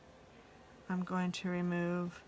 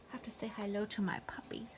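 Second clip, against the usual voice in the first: whispered sweet nothings; 2 semitones higher, 6 dB quieter, faster than usual. Real, not acted. Owner coos to her dog.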